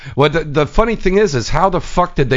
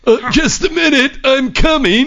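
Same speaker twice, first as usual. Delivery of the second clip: squeaky high put-on voice; high mock voice; 10 semitones higher, slower than usual. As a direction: pipe it up high and silly. Mock voice, pitch way up.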